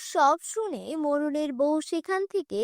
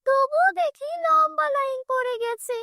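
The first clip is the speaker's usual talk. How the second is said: village woman grumbling in a slightly raised pitch; complaining village woman; 7 semitones higher than usual. Acted. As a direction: grumble like a woman stuck in the water queue. Village woman waiting in the water queue.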